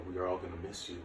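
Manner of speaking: usual speaking voice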